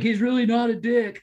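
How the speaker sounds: high, loud mock pleading; friends vouching. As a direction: plead his case for him. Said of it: "all my friends were like".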